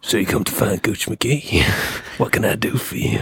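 put-on breathy whispered old-smoker voice; Gooch McGee. Halfling greeting someone who sought him out, whispery smoker voice. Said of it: "He's been smoking for ninety years".

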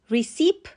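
ریسیپ ending on P, "ri-SEEP"; wrong pronunciation. Shown as a demonstration. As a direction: swap the final T for a P in ریسیپ. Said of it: "هر دو غلط هستن".

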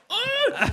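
very high yelp of pain, half laughing; mock pained yelp. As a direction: squeal as if flicked on the nose. Re-enacts being flicked on nose. (spelat smärtskrik, gällt)

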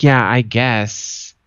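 slow, drawn-out grudging agreement; mock reluctant concession, dragged out; passive-aggressive. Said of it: "she's being a bitch".

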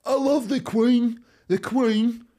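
high, loud, drawn-out adoring cry; over-the-top royal devotion, mocking; mock royalist fanatic. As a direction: squeal it like a besotted superfan. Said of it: "these people that are pure".

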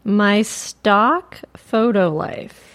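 slow savouring. Real, not acted. Host savours the account name.

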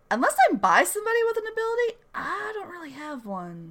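high, quick sheepish admission. Retold or imitated. Peter admitting he has no power, sheepish high voice. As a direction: squeak out the weak spot.

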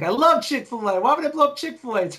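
high, put-on voice quoting the movie line; movie character impression. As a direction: squeak it out like the film character. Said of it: "and he's like".

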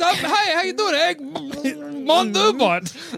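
goofy high-pitched alien voice; Egg Mondubot voice. Acted.

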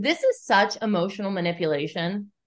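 normal talking voice

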